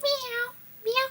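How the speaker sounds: tiny, high, quick mews; cat meow. Pretend. Showing Chloe Cat on the worksheet, high meows. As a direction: squeak out a little cat's meow. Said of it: "Here we've got Chloe".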